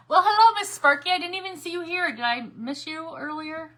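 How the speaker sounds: thrilled to see a regular viewer pop in; delighted greeting. Real, not acted.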